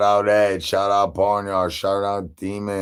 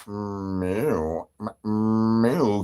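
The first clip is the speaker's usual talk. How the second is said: cat meow; 4 dB quieter, much slower than usual. Pretend. Streamer meows like a cat.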